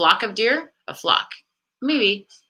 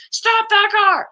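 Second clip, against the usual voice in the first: high, loud, slow pleading yell; frantic yell; 4 dB louder, slower than usual. Retold or imitated. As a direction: yell at him to stop the car right now.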